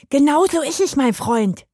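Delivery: laut anfeuernd, hoch. A narrator's reading. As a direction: den Freund anstacheln. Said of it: "feuerte Manfred ihn an". (anfeuernde Maus)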